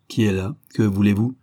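ordinary delivery, nothing marked

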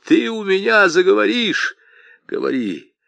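high, loud raging demand. Acted. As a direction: spit the order out in fury. Аргылов хлещет старика. (высокий громкий яростный приказ)

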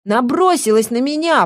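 high, wounded protest; trembling voice. A narrator's reading. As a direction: complain like an injured victim. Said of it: "продолжала Сильва дрожащим голосом".

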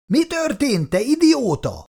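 high, panicked call through the wall; desperate cry. A narrator's reading. Brother calling desperately over the wall, high and panicked. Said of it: "Francis kétségbeesett hangja".

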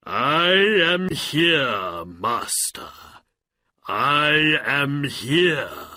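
slow, loud genie proclamation; booming genie. A narrator's reading. Genie appearing before his new master, booming cry. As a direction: stretch each word out grandly and loudly. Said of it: "the genie cried".

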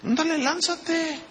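slow, high taunting dare; mock Satan daring Jesus to jump; Satan's taunt. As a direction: tempt him with a slow jeer. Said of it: "lo que Satanás le dijo a Jesús".

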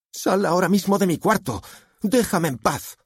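high-pitched angry demand; upset teenage outburst; furious daughter. A narrator's reading. Daughter orders father out.

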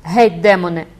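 loud and angry; furious shout. A narrator's reading. The girl beats off the priest, angry shout. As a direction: strike him with the words.

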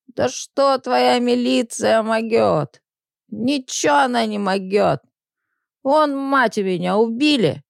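put-on slow slurred scoffing drunkard. Drunk man scoffs at police.

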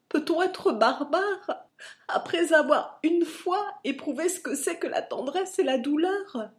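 tearful pleading. A narrator's reading. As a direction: plead with a breaking, sobbing voice. Fils implore son père en pleurs. (supplication en larmes, aiguë et forte)

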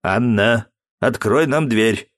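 a hint of a begging father; lightly voiced plea; pleading. Father begging daughter to open the door. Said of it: "взмолился Дмитрий".

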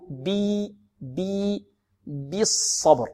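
"بي" stretched to a clean ee, then "بالصبر"; pure kasra. Shown as a demonstration. Teacher drills the vowel, long pure ee in "بي". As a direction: draw out "بي" before slow "بالصبر". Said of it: "أقول".

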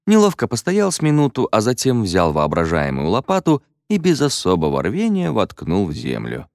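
plain reading voice

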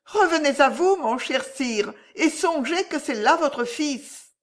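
high, urgent entreaty; pleading woman. A narrator's reading. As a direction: plead with an angry king to relent. Agnès pleads for the son.

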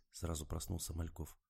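usual speaking voice

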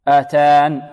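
آتان cut short, final ياء dropped; waqf form. Shown as a demonstration. Teacher showing the pause form of آتان. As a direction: say آتان stopping on the nun. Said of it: "في الوقف".

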